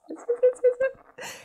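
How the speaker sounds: very high, loud rapid yeses; excited squeal. Real, not acted. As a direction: squeal it with delight. Thrilled at unboxed product.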